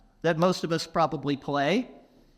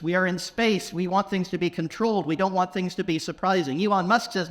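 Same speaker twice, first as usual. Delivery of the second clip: ordinary talk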